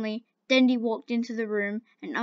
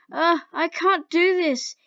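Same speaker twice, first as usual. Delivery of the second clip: higher, slow cry of frustration; frustrated shout; 6 semitones higher, 4 dB louder, slower than usual. Acted. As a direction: burst out, overwhelmed. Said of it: "he shouted in frustration".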